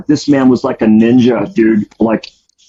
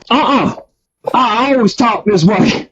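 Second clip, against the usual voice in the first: much higher, put-on Southern innocence; much higher Southern voice, playing innocent to the suspicious inmates; innocent Gump voice; 9 semitones higher than usual. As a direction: insist in the put-on voice that it's your real one. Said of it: "I believe he's making fun of us".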